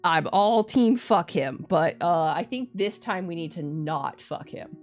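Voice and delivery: ordinary delivery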